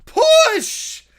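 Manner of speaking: high loud shout. Acted. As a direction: yell push with effort. Crowd shoving stuck bear.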